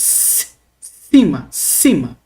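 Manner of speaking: cima opened with a long hissed S; sibilant S. Shown as a demonstration. Teacher showing the sound that K lacks, sibilant S. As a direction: hold the S, then say cima slowly. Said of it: "se são sibilantes".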